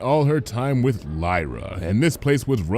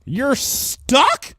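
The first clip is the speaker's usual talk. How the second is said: much higher, aghast; horrified Bon Bon. A narrator's reading. Bon Bon hearing Lyra is stuck, horrified. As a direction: disbelief turning to horror. Bon Bon's voiced lines.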